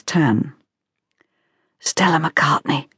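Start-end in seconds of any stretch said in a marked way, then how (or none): 1.8-2.9 breath-only gossipy whisper; hushed church-pew aside. A narrator's reading. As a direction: whisper it to the person beside you. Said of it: "whispered the woman behind".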